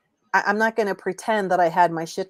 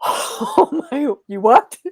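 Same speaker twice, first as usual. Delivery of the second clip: laughing disbelief, high and loud; stunned reaction, half laughing; shocked; 7 semitones higher, 5 dB louder, much slower than usual. Retold or imitated. Retelling her reaction when told the building is on fire, shocked. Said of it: "I'm like".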